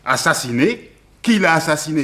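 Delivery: startled, demanding who did it; shocked disbelief. Acted. Servant shocked by murder news. (stupeur bruyante à l'annonce du meurtre)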